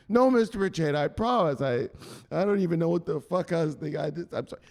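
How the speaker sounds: high, gabbled apology fading out; cowed promise. Acted. Victim promising the enforcer, high and cowed. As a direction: promise desperately, then trail into apology.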